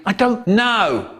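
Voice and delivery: high, mock-furious retort. Michael answering May about her daughter, hammed-up fury. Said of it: "In a furious voice I sang".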